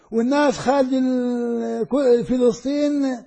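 normal talking voice

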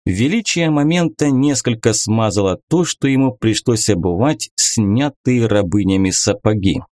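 usual speaking voice

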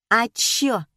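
put-on defiant girl's combative retort; aggressive. A narrator's reading. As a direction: throw it back defiantly. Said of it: "агрессивно выпалила Катерина".

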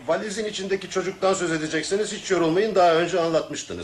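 high, exasperated cut-off, slowing at the end; impatient interruption. Acted. Brushing off the old lady's repeated tale, exasperated and high. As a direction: stop her story before it starts. Cuts off the old woman's story.

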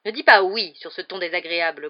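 loud, grumbling rebuke; cross, overbearing aunt; growled. A narrator's reading. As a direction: growl a scolding at the boy. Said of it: "grogna la tante Marge".

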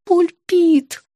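high, falling moan. A narrator's reading. Patient naming her toothache, moaning. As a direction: groan it in a small pained voice. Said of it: "простонала я".

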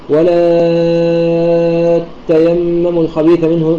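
very long held ā in ولا before a doubled ت in تيمموا; six-count madd. Shown as a demonstration. Teacher demonstrating al-Bazzi's reading, ولا drawn out long. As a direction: stretch ولا fully, then lean into تيمموا. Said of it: "ست حركات مدا مشبعا".

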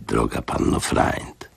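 soft, slow, almost unvoiced; gentle. Old man to the matron, gently menacing. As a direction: sweeten it, a velvet glove over a threat. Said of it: "Łagodny ton jego głosu".

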